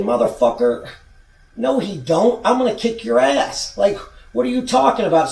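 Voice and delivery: retold silent fury building; angry inner retort. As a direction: fire back in your head, getting heated. Inner rage at opponent's boast.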